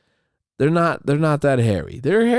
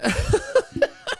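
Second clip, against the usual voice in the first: squealing laugh up high; 4 dB louder than usual. Real, not acted. Laughing at the memory.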